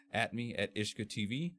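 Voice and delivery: ordinary talk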